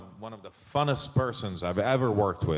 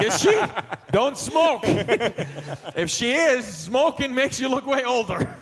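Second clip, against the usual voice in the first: high, loud, laughing disbelief; mock shock; 13 semitones higher, 5 dB louder than usual. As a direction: exclaim it way up high through laughter. Joking mock shock about someone's age.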